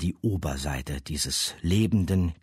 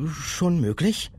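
put-on higher, louder young girl; 11 semitones higher, 4 dB louder, slower than usual. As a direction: answer warily as a girl. Girl answers the monster.

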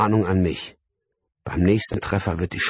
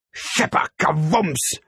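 "Kawums" high and drawn out; imitated crash; 10 semitones higher, slower than usual. A narrator's reading. Narrator mimics globe smashing the window, imitated crash. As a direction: act out a clattering smash and boom.

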